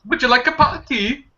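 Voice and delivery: high-pitched, hammed-up British tea offer; mock British accent. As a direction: put on a dainty fake British voice. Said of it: "That's British".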